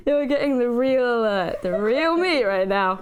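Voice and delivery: laughing through the words, high; real laughter. Real, not acted. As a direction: giggle helplessly while apologizing. Cracking up at chaotic interview.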